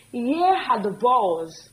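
slow, deliberate demo; very slow teacher demo; slow plural model. Shown as a demonstration. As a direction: drag it out so it sticks. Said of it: "we say".

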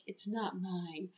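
soft, slow and small; subdued retold confession. Bloodied girl at the human's window, subdued retold confession. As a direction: say it quietly, lightly acted.

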